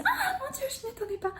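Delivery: shrieking high gasp of surprise. Shown as a demonstration. Coach demonstrating surprise, very high squeal. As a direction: caught off guard, voice leaping up. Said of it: "si de la surprise".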